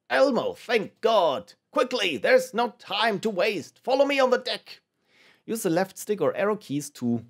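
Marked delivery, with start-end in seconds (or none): 0.1-4.8 urgent, high-pitched fake British voice; poorly British character. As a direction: hurry him along in a bad British accent. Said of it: "they all sound vaguely and poorly British".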